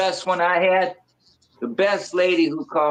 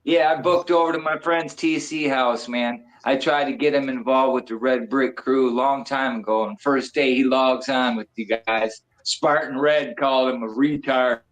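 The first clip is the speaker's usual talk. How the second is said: normal talking voice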